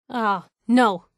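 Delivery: ordinary talk, nothing marked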